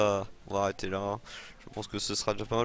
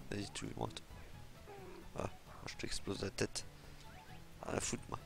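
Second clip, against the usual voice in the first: muttered taunts; 3 semitones lower, 12 dB quieter than usual. Real, not acted. Taunting enemies under breath.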